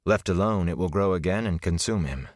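plain reading voice

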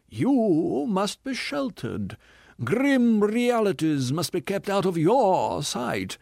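high, slow, theatrical self-pitying voice; a wounded ham actor milking every line; tragedian. Acted. Tragedian's accusing rant.

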